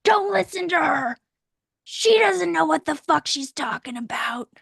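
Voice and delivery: put-on high, loud bratty teenager yelling; mock shouting rebellious teenager; snarky teen shout. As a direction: yell it like a defiant teen over a shoulder. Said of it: "Teenagers, like, right behind you, like".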